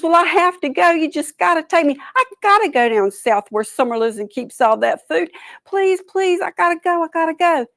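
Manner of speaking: high, insistent pleading; pleading turtle. A narrator's reading. Turtle insisting the birds take him, high pleading.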